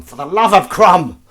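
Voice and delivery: high, drawn-out loud shout; exclaimed curse. Pretend. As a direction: bellow it as a mock oath. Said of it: "That's a curse, I like to say".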